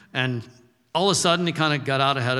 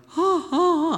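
high, hiccuping mock sobs; mock crying sobs; 3 dB louder than usual. Grandfather imitating his grandson's sobbing, high hiccuping sobs. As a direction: imitate a little boy catching his breath crying. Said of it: "And he's like".

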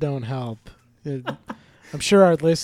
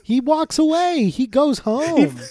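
incredulous; 8 semitones higher, slower than usual. Real, not acted. Incredulous complaint about movie.

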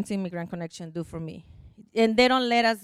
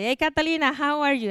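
higher, upbeat retold greeting; happy caller; 6 semitones higher than usual. Immigration helper calling client with news, imitated happy voice. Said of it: "I feel the happiness in her voice".

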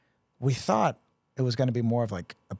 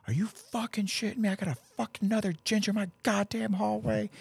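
much higher, incredulous sergeant rant; outraged first sergeant; 11 semitones higher than usual. Retold or imitated. First sergeant spotting another ginger soldier, much higher outraged disbelief. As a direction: play the fed-up sergeant, pitched way up.

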